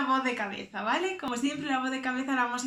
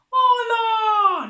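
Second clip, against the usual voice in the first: squeaky high loud cartoon hello; Dory's voice; 10 semitones higher, 13 dB louder than usual. Shown as a demonstration. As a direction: greet like a chirpy cartoon fish. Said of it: "es la voz de Dory". (saludo agudo de Dory)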